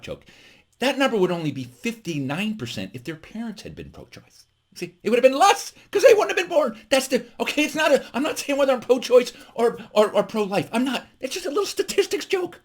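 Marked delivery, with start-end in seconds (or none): 4.8-12.6 high-pitched, flustered protest; defensive. Real, not acted. Comedian insisting it is just a statistics joke, defensive. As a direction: backpedal in a raised, pleading pitch. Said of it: "Do I seem defensive?".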